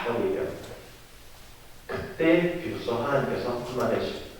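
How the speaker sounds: ordinary talk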